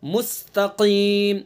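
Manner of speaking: मुस्तकीम with the q from the back of the tongue; deep qaf. Shown as a demonstration. Teacher showing the qaf sound. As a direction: stress the throat q in मुस्तकीम. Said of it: "काफ को जबान की जड़".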